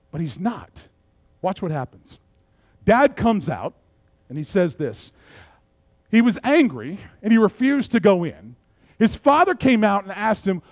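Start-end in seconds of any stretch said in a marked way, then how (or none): none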